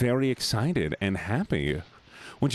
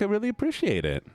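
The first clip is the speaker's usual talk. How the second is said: high sweet voice; 11 semitones higher than usual. Real, not acted. Thanking chat in high voice.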